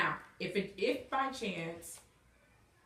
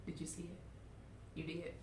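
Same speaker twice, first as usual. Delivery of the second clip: ordinary talk, nothing marked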